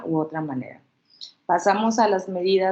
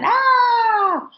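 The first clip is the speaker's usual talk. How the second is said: put-on high, loud baby yell; baby shout; 6 dB louder than usual. Shown as a demonstration. Therapist imitating a toddler's shout. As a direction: let out a baby's cry of "ah". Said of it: "empiezan como a gritar".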